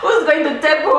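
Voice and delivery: high, laughing question; asking through laughter. Real, not acted. Telling her sister about customers, laughing.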